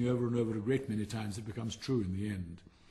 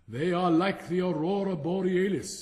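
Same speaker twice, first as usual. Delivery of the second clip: loud, high kingly boast; booming royal voice; pompous king; 7 semitones higher, 9 dB louder than usual. A narrator's reading. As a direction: proclaim it like a vain king. King answers in story.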